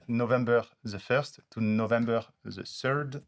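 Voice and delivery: English dates with French sounds, "th" as z and s; real French accent on the English words. Real, not acted. Presenter reading back what the AI understood, French-accented English. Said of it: "mon accent, il est pas très bon".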